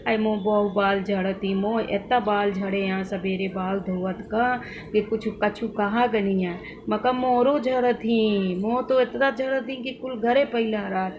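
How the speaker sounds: lightly voiced old mother-in-law in Awadhi dialect, slowing toward the end; mother-in-law in local dialect. Imitating her mother-in-law complaining about hair fall in Awadhi dialect. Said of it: "मैं इस तरह की language बोल रही हूँ".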